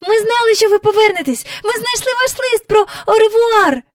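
high, loud, eager rush; excited calling. A narrator's reading. Jane hugging the blue coat, excited calling. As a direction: call out joyfully to welcome her. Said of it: "гукала Джейн".

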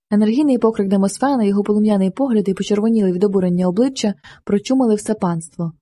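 usual speaking voice

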